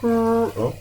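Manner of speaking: high sudden oh; startled little yelp; surprised oh. Real, not acted. Surprised at steam while tasting.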